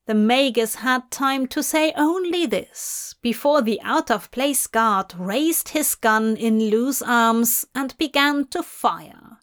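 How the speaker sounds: plain reading voice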